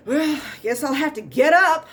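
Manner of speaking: high, loud hammed-up moan of effort; mock a patient straining to leave the chair; groaning reluctant patient. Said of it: "They will literally say stuff like".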